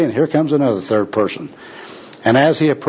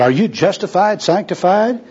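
high-pitched pious inquiry; earnest pressing question; 7 semitones higher than usual. Acted. Second worker tests puzzled bum on doctrine, earnestly. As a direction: press each churchy word as a question.

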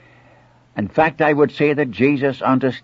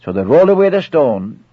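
usual speaking voice

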